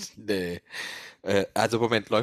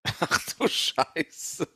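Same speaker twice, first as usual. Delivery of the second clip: shocked; 6 semitones higher, 3 dB louder, much slower than usual. Acted. Shocked at the run-over dog.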